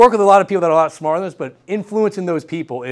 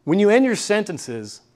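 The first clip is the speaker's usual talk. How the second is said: ordinary talk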